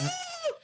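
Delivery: a sudden high startled gasp; sharp gasp. Real, not acted. Host hearing his cursed outro for the first time, startled gasp. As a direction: suck in air as the track hits.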